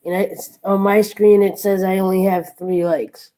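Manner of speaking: usual speaking voice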